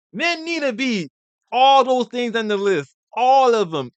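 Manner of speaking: high, loud and slow, hammering each word; emphatic declaration. Real, not acted. Telling viewers what men must be, loud and emphatic. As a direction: declare it like a rally cry.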